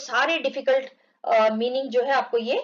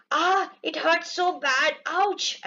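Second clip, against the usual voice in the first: mock pain; 4 semitones higher, much slower than usual. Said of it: "ऐसा करके आवाज कर रहा है".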